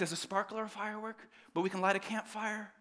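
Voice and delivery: normal talking voice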